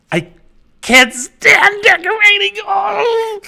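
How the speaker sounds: high, loud hammed-up wail; mock anguished shout. Host playfully wails a viewer's complaint, hammed-up shout. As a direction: cry out the complaint in mock despair. Mock outburst reading a comment.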